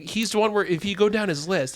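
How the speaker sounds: normal talking voice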